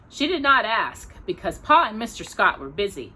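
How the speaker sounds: plain reading voice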